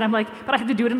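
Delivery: normal talking voice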